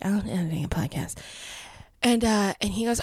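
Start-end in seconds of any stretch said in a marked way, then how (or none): 0.0-1.2 softer, sheepish little mumble; mock apologetic. Telling the stage manager sheepishly about editing a podcast, mock apologetic. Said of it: "And I said it super apologetically".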